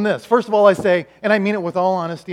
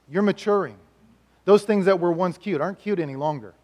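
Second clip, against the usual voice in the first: usual speaking voice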